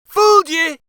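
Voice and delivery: very high, loud gleeful crow. A narrator's reading. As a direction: crow at the trick. Uncle triumphs in joke.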